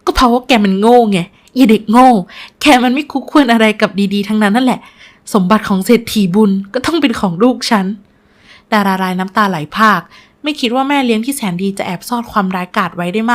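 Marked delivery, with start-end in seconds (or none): 0.1-7.9 forceful, contemptuous scolding; scornful berating. Acted. Stepmother claiming the fortune for her own child, scornful. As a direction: hurl the insults hard.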